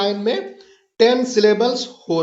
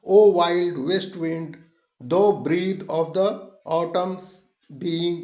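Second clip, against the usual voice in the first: very slow, deliberate iambic reading, broken by pauses; scanned verse reading; 3 semitones lower, 3 dB quieter, much slower than usual. Shown as a demonstration. Teacher showing how to read the sonnet on, slow scansion. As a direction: draw the verse out foot by foot. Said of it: "इस तरह से आगे आप continue पढ़ सकते हैं".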